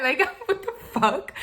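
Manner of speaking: laughing high in incredulous amusement; laughing disbelief. Real, not acted. Telling viewers the absurd story, laughing disbelief.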